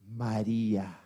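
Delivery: Jesus gently calling María by her name; tender call. Acted. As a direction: say her name like someone who knows her. Jesus calls Mary by name.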